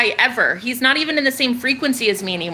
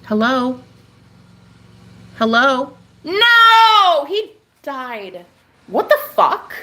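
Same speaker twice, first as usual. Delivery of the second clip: high, loud cry of dismay; shocked; 4 semitones higher, 4 dB louder, much slower than usual. Real, not acted. Shocked as the moth dies in front of her.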